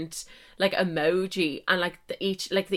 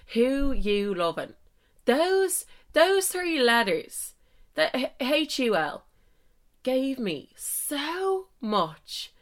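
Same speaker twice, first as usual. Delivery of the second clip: ordinary delivery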